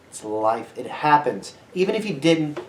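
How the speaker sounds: usual speaking voice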